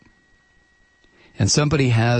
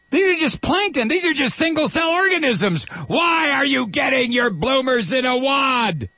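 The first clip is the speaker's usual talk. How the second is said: mock exasperated executive; 9 semitones higher than usual. Oil executive scolds critics, shouting high and exasperated. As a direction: whine it up high like an offended tycoon.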